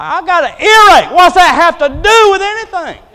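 high, loud mock complaint at the doctor; retold complaint. Complaining to the doctor about the wait, high and loud. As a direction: squawk it out, indignant and high. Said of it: "I'm like".

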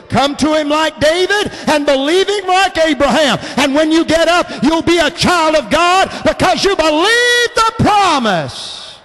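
rising preacher fervor; building sermon cadence; preaching. Acted.

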